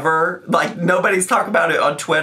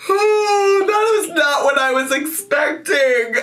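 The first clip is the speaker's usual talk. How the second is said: high wail left over from laughing; overcome with laughter; 10 semitones higher than usual. Real, not acted. Vlogger surprised by the clip, overcome with laughter. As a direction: squeal it, barely recovered from laughing. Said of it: "I can't stop laughing".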